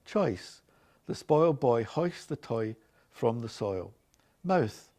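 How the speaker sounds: normal talking voice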